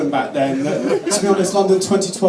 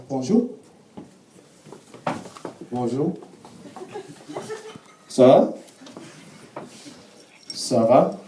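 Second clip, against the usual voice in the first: put-on halting French in a learner's accent; tentative French small talk with rising ends; schoolboy French; 2 semitones higher, slower than usual. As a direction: grope for each French phrase like a struggling pupil. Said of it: "my G C S E in French".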